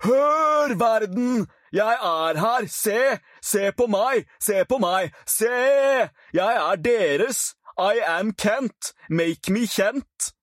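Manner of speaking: high, loud bellow to the whole world; roaring from the window. Acted. Kent bellowing out the open window so the world sees him, loud roar. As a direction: shout it out the window, last lines slow and drawn out. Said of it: "stilte seg i vindusåpningen og brølte".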